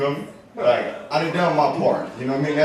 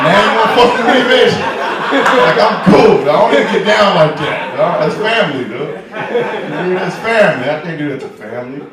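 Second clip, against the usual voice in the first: laughing indignation; 3 semitones higher, 6 dB louder than usual. Retold or imitated. Indignant retold reply, laughing.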